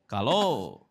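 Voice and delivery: usual speaking voice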